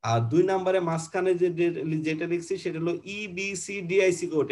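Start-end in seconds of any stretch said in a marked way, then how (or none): 3.0-4.5 ইবিসি, ডিআইসি read as two separate chunks; split into parts. Shown as a demonstration. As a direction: break ইবিসি and ডিআইসি apart with a pause. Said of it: "এটাকে আলাদা আলাদা পড়বে". (ইবিসি আর ডিআইসি আলাদা করে ভেঙে পড়া)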